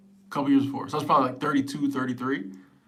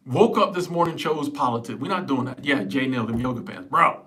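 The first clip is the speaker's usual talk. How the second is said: usual speaking voice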